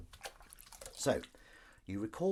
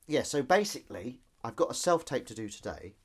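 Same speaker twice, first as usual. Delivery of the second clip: normal talking voice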